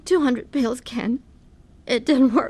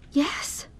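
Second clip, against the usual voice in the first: whisper; 5 semitones higher, 7 dB quieter than usual. Acted. Shaken wife answers husband.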